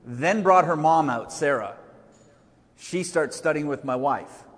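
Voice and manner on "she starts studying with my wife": ordinary delivery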